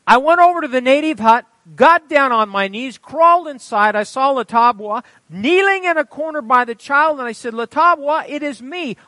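high, loud pulpit storytelling; full-force preaching narration. A narrator's reading. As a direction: proclaim the story to the whole congregation. Reads testimony at full force.

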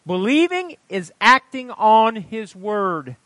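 loud, high teaching from the pulpit; emphatic preaching. Real, not acted. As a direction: declare the point to the congregation. Preaches at congregation.

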